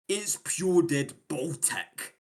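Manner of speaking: a slow Scottish "pure dead Baltic"; leisurely Scottish accent. Shown as a demonstration. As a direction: drag it out like a Scot. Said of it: "It's absolutely Scottish".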